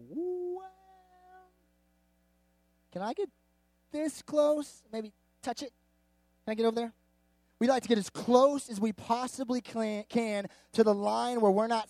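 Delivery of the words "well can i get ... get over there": high, halting mock-innocent questions; tentative testing. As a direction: inch up to the line, asking permission. Acts out edging toward sin's line.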